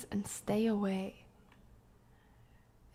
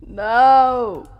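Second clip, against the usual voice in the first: shouting no; 2 semitones higher, 16 dB louder than usual. Real, not acted. Horror scare.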